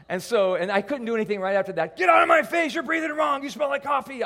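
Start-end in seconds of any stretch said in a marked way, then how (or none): 2.0-4.2 high, loud mock shrieking; imitated labor-pain tirade; wife berating husband. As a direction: yell the complaints at a high pitch.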